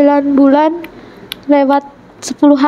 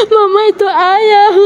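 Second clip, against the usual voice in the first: put-on high, drawn-out call of a child; smiling child; 6 semitones higher, slower than usual. Child telling mama that father is there, smiling, high voice. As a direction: imitate a child calling to mama with a smile. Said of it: "anak saya tersenyum. Bilang".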